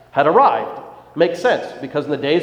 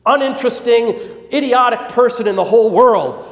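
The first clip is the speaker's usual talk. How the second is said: acted angry yelling; 6 semitones higher than usual. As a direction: yell at him like your career just got ruined. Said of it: "started yelling".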